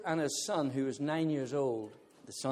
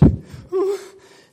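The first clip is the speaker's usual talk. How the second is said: loud high laugh; 9 dB louder than usual. Real, not acted. Laughing at his own bravado.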